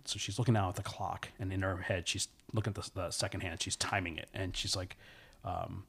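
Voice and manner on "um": usual speaking voice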